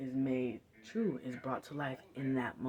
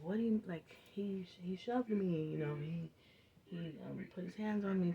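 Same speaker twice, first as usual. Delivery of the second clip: put-on higher, hurried plea of a kid in trouble; pleading kid; 5 semitones higher than usual. Kid pleads his case to the dean, higher and pleading. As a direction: protest his innocence. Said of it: "pleading his case".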